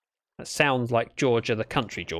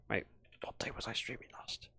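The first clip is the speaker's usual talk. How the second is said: a hushed self-check, barely voiced; whispered aside; 2 semitones lower, 18 dB quieter than usual. Real, not acted. Streamer privately wondering which day he last streamed, whispered. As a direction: whisper the question to yourself. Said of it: "muttering to himself mid-story".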